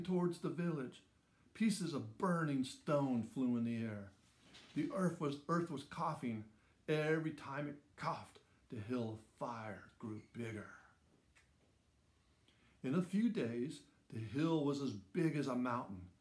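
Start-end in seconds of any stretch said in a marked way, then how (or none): none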